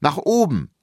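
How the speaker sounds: put-on high, loud child's command; eager kid giving orders; directing. A narrator's reading. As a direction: call it upward like guiding a crane. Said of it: "dirigierte ich".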